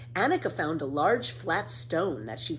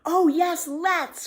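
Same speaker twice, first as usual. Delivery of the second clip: high, loud, bursting with joy; eager cry; 12 semitones higher, 7 dB louder, much slower than usual. A narrator's reading. Children crying out yes to a picnic, thrilled. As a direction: cheer it like two eager kids. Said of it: "cried Tommy and Annika".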